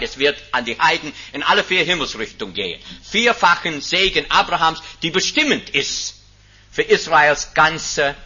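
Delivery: loud, high rising proclamation from the pulpit; preaching at full force. Acted. Preacher to congregation, proclaiming the fourfold blessing at full force.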